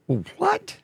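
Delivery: a high, sudden "what?" shooting up; startled disbelief. Real, not acted. Reading the card-declined notice, a sharp high startled what. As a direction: react like the rug was pulled out.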